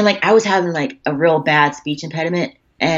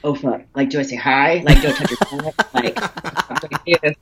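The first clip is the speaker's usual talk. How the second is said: laughing; 3 semitones lower than usual. Retold or imitated. Nervous fangirl moment.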